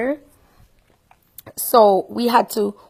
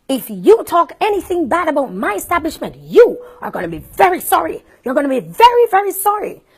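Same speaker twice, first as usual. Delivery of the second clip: imitating a threatening woman, louder; mock threat; 8 semitones higher, 4 dB louder than usual. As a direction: wag a finger and threaten. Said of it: "pointing in my face".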